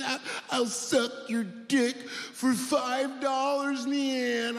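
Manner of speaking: high, pleading, drawn-out addict voice with a rasp of coughing; strung-out junkie woman. Retold or imitated. Woman popping out of a trash can begging him for drugs, high and pleading. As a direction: beg like a sick woman desperate for drugs. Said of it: "She's like".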